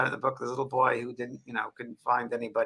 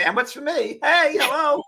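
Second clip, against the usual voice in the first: high-pitched mock demand, getting louder; playful calling out; 13 semitones higher, 6 dB louder, slower than usual. Man jokingly hailing staff bringing her food, playful calling out. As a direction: pipe up high, then holler for attention.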